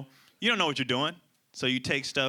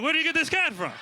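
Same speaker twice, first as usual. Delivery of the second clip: put-on startled teen; 5 dB louder than usual. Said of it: "I was like".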